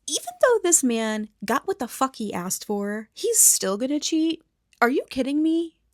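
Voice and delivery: exasperated, pitch climbing high; indignant rant rising in pitch; incredulous outrage. Real, not acted. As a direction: build to an appalled, disbelieving question. Outraged commentary on Bear cheating.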